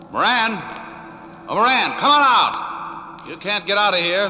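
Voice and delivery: shouting across the engine room; calling out. Acted. Detective shouting for the cornered killer. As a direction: call him out of hiding.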